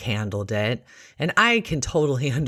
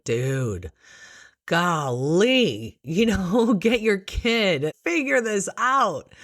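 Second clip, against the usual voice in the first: mock exasperation, climbing higher; judgmental; 7 semitones higher, slower than usual. Nurse imagines scolding the mom, mock judgmental exasperation. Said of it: "Instead of being judgmental".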